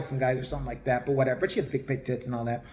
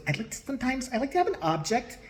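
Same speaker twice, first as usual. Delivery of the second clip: lightly mimicked medium, pitched high; high, softened mock-medium voice; Tyler Henry; 6 semitones higher than usual. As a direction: imitate a dainty request in a raised pitch. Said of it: "the first thing he goes".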